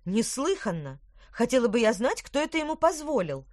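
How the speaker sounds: loud indignant outburst; outraged, rising. A narrator's reading. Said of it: "возмутилась Петронелла".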